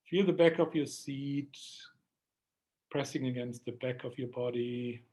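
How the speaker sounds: slow, calm meditation voice; guided meditation. Acted. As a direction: ease them into a slow breath. Leads a breathing meditation.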